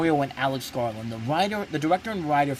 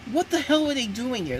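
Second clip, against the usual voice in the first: higher, incredulous "what the hell"; baffled disbelief; 10 semitones higher than usual. Retold or imitated. Reviewer reacting to the film, pitched high in disbelief. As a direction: throw up your hands at the screen. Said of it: "And it's just like".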